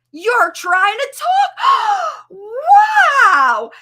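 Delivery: high, slow, loud gushing with a gasp; hammed-up proud mom squeal; overjoyed mom. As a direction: gush like a thrilled mother. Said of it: "And then you're like".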